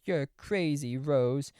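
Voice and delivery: high, scornful put-on voice; mocking the party guests. Party guests dismissing Rose, high scornful mock voice. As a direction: sneer at her like the wine moms. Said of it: "And everyone's like".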